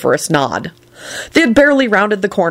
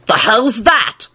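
shout; 4 semitones higher, much slower than usual. A narrator's reading. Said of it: "shouted Lupin".

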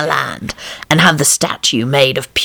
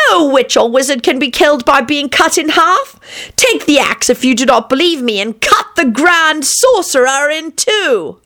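high, declamatory witch voice; Babbity the witch; 10 semitones higher than usual. A narrator's reading. Witch defying them from the tree stump, high crying voice. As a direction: challenge them, stretching the final command. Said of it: "cried Babbity's voice from the stump".

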